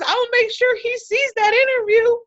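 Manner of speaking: high, loud teasing friend voice; playful girlfriend impression, pitched high; sassy friend. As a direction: tease it in her voice, way up top. Friend's quote retold.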